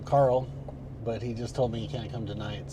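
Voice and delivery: ordinary talk, nothing marked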